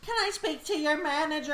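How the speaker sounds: high whiny customer voice; entitled customer impression. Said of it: "They'll be like".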